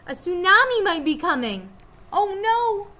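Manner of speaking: high, loud sudden alarm. A narrator's reading. Jack realizes a tsunami is coming, high and loud alarm. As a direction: panic rising as it dawns.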